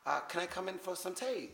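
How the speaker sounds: high, hammed-up British accent greeting the Queen; a Canadian faking a posh British accent; mock British accent. Hammed-up British accent calling to the Queen at the palace. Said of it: "I tried to talk with a British accent".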